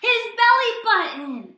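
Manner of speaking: put-on spring the surprise on the kids; excited reveal. A narrator's reading.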